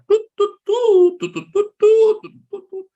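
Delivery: very high tooting in Morse-like bursts; comic squeaky fart noises; imitated goat farts. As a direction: toot out a string of mock farts. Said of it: "Kayak Morse gitu".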